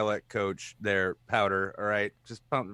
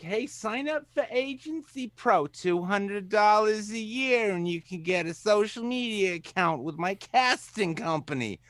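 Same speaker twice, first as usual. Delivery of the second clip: high-pitched agency huckster; mock sales pitch; 11 semitones higher than usual. Host mocks agencies selling to actors, mock sales pitch. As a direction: pitch it high like a pushy casting promoter.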